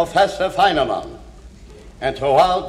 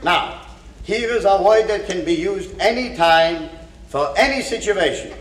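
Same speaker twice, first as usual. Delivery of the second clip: ordinary talk, nothing marked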